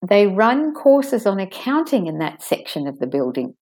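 small words said quickly; stressed sentence demo. Shown as a demonstration. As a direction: lengthen the vowels in "accounting", "run courses", "section", "building". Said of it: "So I stress the word accounting".

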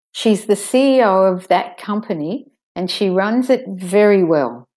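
key words hit, small words reduced; stressed sentence demo. Shown as a demonstration. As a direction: stress C E O, company, runs, very well, schwa the rest. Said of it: "All the rest, use a schwa in there".

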